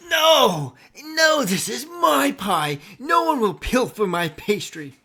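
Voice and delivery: high, loud outraged goblin shout, slow at first; goblin king. Acted. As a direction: bellow like a furious goblin guarding his pie. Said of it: "and he says".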